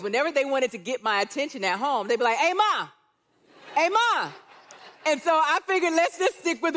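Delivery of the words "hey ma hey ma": 1 calling out. Retold or imitated. Boys calling their mom at home.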